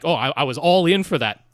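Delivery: ordinary delivery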